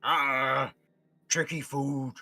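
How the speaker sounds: drawn-out creature grumble; put-on monster frog voice; thwarted frog monster. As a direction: grumble like a giant frog losing its meal. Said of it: "as you hear it go".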